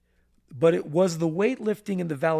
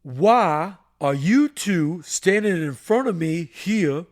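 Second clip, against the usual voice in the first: a hint of a drill-sergeant principal, louder and slower; lightly voiced stern general; 6 dB louder than usual. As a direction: boom it out like the school disciplinarian. Principal General Price addresses the boys.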